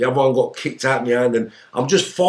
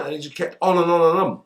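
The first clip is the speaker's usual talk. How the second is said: ordinary talk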